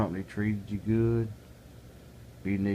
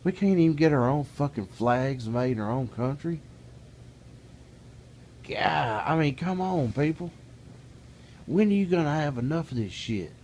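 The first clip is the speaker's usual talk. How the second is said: angry rant; 7 semitones higher than usual. Real, not acted. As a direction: vent your fury at the country. Angry rant about flags.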